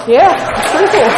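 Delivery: thrilled to share the clean date; real joy breaking out; delighted. Real, not acted.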